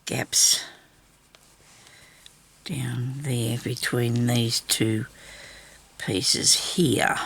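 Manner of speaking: slow whisper while pointing things out. Real, not acted. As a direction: breathe the words slowly, no voice.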